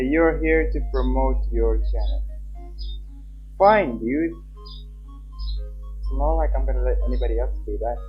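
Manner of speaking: normal talking voice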